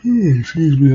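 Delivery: real yawn at dawn pushing the voice up. Real, not acted. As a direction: yawn right through it, then apologize. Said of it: "I'm so sorry I'm yawning".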